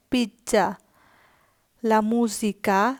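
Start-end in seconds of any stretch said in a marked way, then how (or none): 1.8-2.9 লা musica drawn out very slowly, rising at the end; slow first take. Shown as a demonstration. Teacher introducing a new word, slow and rising. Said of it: "লা musica অর্থ".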